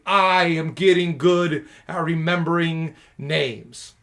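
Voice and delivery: slow, loud self-affirmation. Shown as a demonstration. Student practising a self-affirmation, slow and loud. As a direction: declare it slowly like a mantra. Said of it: "you would start saying".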